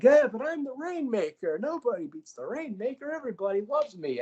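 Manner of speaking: high, goofy bragging voice; high, goofy bragging voice of Okada; Okada impression. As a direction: crow like a needy show-off. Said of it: "Kai's like".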